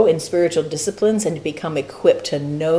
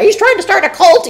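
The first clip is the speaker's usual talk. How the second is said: higher, louder mock call of alarm; 14 semitones higher, 8 dB louder than usual. Mock alarm as the leader bursts in on the group. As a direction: play the leader busting in to cry cult. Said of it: "Jennifer's gonna bust in and say".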